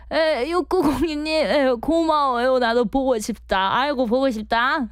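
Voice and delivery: 높은 톤으로 반가워하는 시골 고모 연기; 조카한테 말하듯 정겹게 높여 부르는 고모; 우미자 고모 목소리. Acted. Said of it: "아, 우미자 고모, 우미자 고모". (청취자에게 고모 캐릭터로 반갑게 답하며, 높은 목소리)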